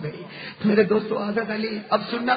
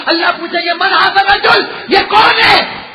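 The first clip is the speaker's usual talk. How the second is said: loud, raised sermon call; preaching at full force; 5 semitones higher, 7 dB louder than usual. Real, not acted. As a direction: drive the questions home to the crowd. Climactic sermon question. (پورے جوش سے وعظ)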